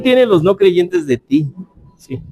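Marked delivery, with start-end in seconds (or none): none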